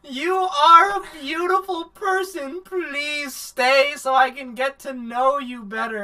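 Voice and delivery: hammed-up weepy mother begging; mock crying plea. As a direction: ham up a sobbing mother. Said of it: "she's like".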